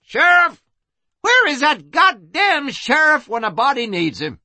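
very high, full-lunged yell; bellowing. Acted. Madam yells in the street for the sheriff, bellowing. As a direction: bellow for help with angry impatience. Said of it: "filled her lungs and bellowed".

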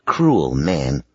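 ordinary delivery, nothing marked

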